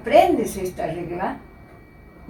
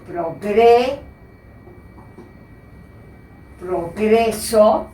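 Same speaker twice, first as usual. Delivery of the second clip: progreso drawn out slowly and clearly; teacher dictating progreso slowly; slow careful reading; much slower than usual. Shown as a demonstration. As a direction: read progreso very slowly so students catch every syllable. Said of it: "que dice".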